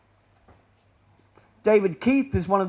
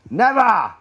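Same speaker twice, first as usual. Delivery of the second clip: louder, higher, firm refusal; emphatic never; 5 semitones higher, 7 dB louder than usual. Real, not acted. Speaker rejecting the claim, a loud emphatic never. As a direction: throw the word out hard. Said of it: "repeats never with force".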